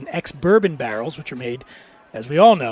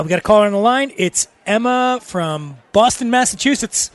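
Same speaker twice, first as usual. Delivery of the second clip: mock radio host; 5 semitones higher, 4 dB louder than usual. Co-host introduces a pretend Boston caller, radio-host flourish.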